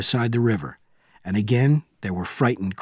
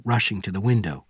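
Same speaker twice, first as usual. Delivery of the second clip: plain reading voice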